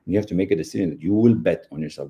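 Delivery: ordinary talk, nothing marked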